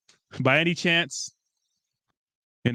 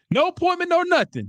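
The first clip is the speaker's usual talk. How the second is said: very high, hammed-up film quote; mock indignation. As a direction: protest in a high put-on voice. Acts out indignant film line.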